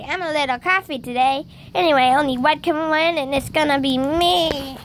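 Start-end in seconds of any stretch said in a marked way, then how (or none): none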